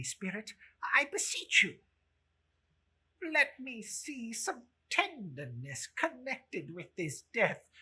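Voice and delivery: put-on high, urgent begging old man; pleading Scrooge. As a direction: beg the spirit desperately.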